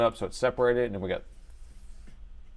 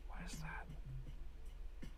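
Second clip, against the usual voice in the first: hushed, drawn-out whisper; a low wondering whisper, stretched out; slow whisper; 19 dB quieter, much slower than usual. Real, not acted. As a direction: breathe it out slowly in awe.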